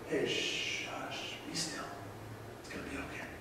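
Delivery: put-on whispered hushing to a crying child; soothing mother hush. Mother hushing her crying child in a gentle whisper. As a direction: whisper it like calming a crying baby. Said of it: "words that a mother would speak".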